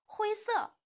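put-on high, loud child's demand; eager high yell; crying out. A narrator's reading. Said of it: "哈瓦尔叫道".

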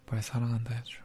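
coaxing whisper. Acted. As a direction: whisper a playful plea. Whispered plea.

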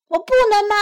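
high, loud puzzled question; questioning fox. A narrator's reading. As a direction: ask it as a thwarted fox.

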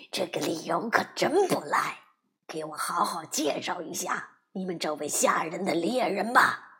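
low, sly wolf voice, half whispered; a mocking wolf leaning in close; big bad wolf. A narrator's reading. (低沉狡猾的大灰狼)

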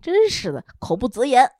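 ordinary talk, nothing marked

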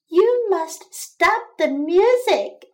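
insist, with pitch lifted; insistent demand. A narrator's reading. Tired mouse orders the crickets to stop, insistent.

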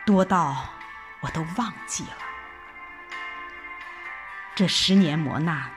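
ordinary talk

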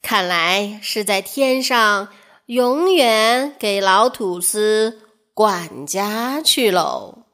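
ordinary delivery, nothing marked

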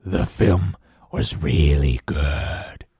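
deep contented monster; smiling Blob. Acted. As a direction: drop low and slow, pleased. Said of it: "smiled Blob".

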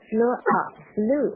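FLEW drawn out slowly with emphasis; stress on FLEW. Shown as a demonstration. Teacher showing which word to emphasise, stressed FLEW. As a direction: lean on FLEW, stretching it. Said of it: "你可以做一个重读的处理".

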